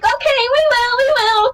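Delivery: high, cute reassuring promise; mock idol promise. Idols promise the fan they'll come, high and cute. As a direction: promise sweetly.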